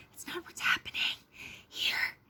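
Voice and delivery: hushed whispered aside. Real, not acted. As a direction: drop to a whisper for this bit. Whispered aside about competing.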